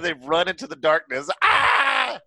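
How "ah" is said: a short high mock scream; imitating a scream. Mimicking the burned guys running, mock scream. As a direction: yelp like the burned guys did. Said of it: "they're just screaming".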